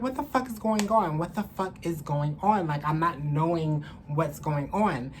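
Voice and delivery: ordinary talk, nothing marked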